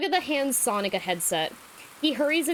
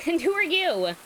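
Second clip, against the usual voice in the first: higher, eager question; excited; 8 semitones higher, 3 dB louder than usual. Acted. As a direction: ask it with delight. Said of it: "Dat Boy asks excitedly".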